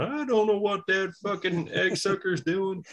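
high, put-on American grumble; mock an old wrestler's puzzled complaint; imitating Terry Funk. Wrestler answering his wife about the caller, high mocking imitation. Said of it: "Terry's probably going".

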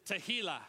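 Tehillah said teh-HEE-lah, stress on the middle; Hebrew word Tehillah. Shown as a demonstration. Teaching the congregation: Tehillah teh-HEE-lah. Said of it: "Say Tehillah".

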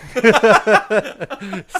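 loud high cackle; high laugh. Real, not acted. Laughing after the quote.

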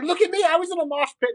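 high-pitched mock show-off; imitated boast. Voices her imagined video boast.

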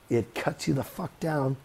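normal talking voice

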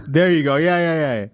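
usual speaking voice

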